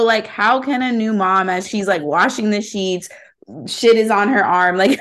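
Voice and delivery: ordinary talk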